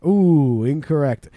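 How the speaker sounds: ordinary delivery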